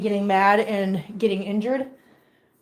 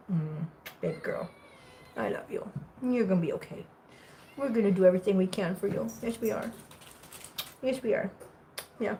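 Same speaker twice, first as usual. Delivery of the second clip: soft, low reassurance; soothing; 3 semitones lower, 9 dB quieter than usual. Real, not acted. Owner promising baby girl she'll be okay, soothing. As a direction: comfort a little one very gently.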